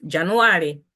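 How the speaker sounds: "January" as Chitumbuka "Januwari", stressed long A; Chitumbuka pronunciation. Shown as a demonstration. Teacher models Chitumbuka month name for learners, Chitumbuka pronunciation. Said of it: "in the way you pronounce".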